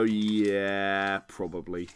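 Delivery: low, drawn-out "yeah", dropping away; grudging agreement. Acted. Host voicing listeners who look up the companies, reluctantly convinced. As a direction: concede it slowly, sinking in pitch. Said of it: "look at them and go".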